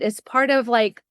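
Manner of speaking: ordinary delivery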